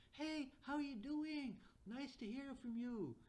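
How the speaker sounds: high, drawn-out chirpy hello; gushing upbeat chatbot voice; emotional, upbeat greeting. Acted. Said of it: "this is a very emotional, upbeat way of talking".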